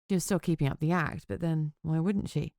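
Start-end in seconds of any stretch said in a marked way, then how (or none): none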